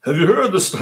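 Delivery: Trump impression. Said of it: "Let me see if I can do my Trump".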